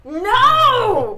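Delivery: high, loud, drawn-out no; dismayed cry. Acted. Player reacts as another engine part is ripped off, loud dismayed cry. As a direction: cry out in protest.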